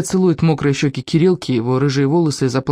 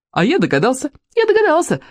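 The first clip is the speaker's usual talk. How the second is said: put-on high-pitched boyish boast; excited yelling; 11 semitones higher than usual. A narrator's reading. Вовка boasts that he guessed it, excited cry. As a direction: crow it, jumping much higher. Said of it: "завопил Вовка".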